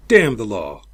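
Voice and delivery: loud, angry dismissal; angry retort. Acted. Captain to Sabo, angrily brushing off the law. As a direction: spit out the curse on the law.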